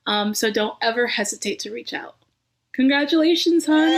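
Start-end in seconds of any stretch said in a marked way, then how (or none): 2.7-4.0 higher and louder, beaming congratulations; excited cheer. Real, not acted. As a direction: call it out with joy. Teacher ends speech with cheer.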